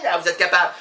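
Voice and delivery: usual speaking voice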